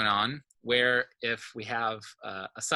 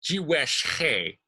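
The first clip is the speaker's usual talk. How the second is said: higher, slow demo, stressing "wesh" before "he"; stress before the suffix; 4 semitones higher, 3 dB louder, much slower than usual. Shown as a demonstration. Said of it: "right before the first noun suffix".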